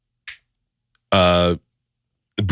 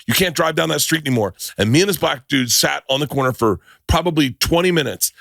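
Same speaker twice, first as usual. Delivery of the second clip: usual speaking voice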